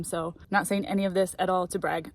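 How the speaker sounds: ordinary talk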